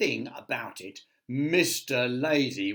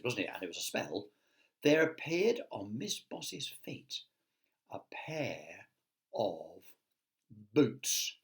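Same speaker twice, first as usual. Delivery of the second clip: usual speaking voice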